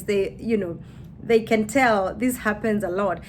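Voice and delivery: normal talking voice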